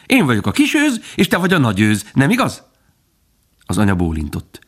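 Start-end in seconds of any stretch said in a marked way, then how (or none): 0.1-2.6 put-on high, bouncing child's voice; thrilled fawn, pitched high; delighted little fawn. A narrator's reading. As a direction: jump in with happy excitement. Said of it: "Bambi magasba szökkent nevettében".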